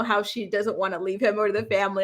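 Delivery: normal talking voice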